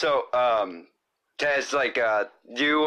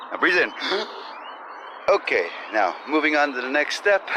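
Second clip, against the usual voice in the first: louder over the birds outside; 3 dB louder than usual. Real, not acted. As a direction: project over the outdoor noise. Loud vlog while walking outdoors.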